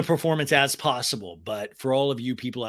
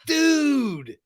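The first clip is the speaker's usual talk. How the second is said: high, drawn-out mock cry; Sandoval impression; 13 semitones higher than usual. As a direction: call it out as a whiny protest. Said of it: "He keeps going".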